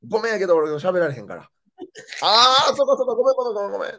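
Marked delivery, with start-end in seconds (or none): 2.2-3.9 high, loud quick sorry-sorry; a mother brushing off her mistake in a rush; mock flustered mom apologizing; imitating mom apologizing. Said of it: "つって".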